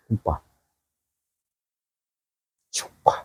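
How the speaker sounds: low, hushed, choking up; close to tears. Real, not acted. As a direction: break off to hold back real tears. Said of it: "sampai kepingin nangis saya". (menahan tangis, suara lirih)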